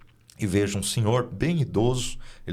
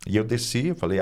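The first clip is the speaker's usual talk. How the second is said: ordinary delivery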